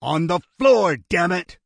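loud barked order; furious command at gunpoint; angry command. A narrator's reading. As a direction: snap the order. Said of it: "Jonathan commanded".

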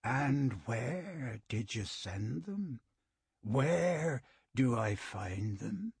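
very slow, calm and cold; unhurried and chilling while strangling; calm menacing voice. A narrator's reading. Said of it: "The other man's voice was calm, without a hint of strain".

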